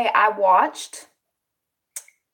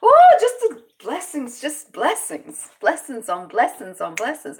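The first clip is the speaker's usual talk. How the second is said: blissful self-talk; 7 semitones higher, 3 dB louder than usual. Retold or imitated. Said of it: "I try to be like".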